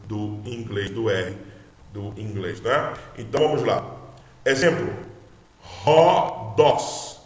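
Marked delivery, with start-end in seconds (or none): 5.6-7.2 "Rodós" said with an aspirated h, like English house; rough breathing as h. Shown as a demonstration. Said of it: "eu estou lendo não ó mais". ("Rodós" com H aspirado do inglês)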